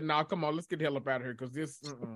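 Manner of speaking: quiet let's-go whisper-like aside; hushed aside. Retold or imitated. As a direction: murmur it so nobody hears. Sneaking out of the funeral.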